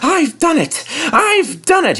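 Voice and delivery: very high, breathless triumph; panting triumph. A narrator's reading. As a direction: gasp it out at the top. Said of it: "he panted".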